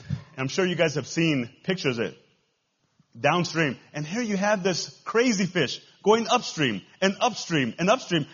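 ordinary delivery

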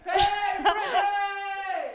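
drawn-out call. Real, not acted. Calling out drawn-out name.

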